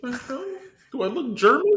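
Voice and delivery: high, loud mock outrage; mock offended. Real, not acted. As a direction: squeal it in fake offense.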